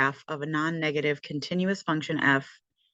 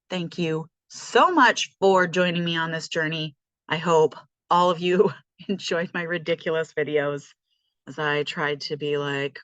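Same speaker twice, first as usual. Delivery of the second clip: ordinary talk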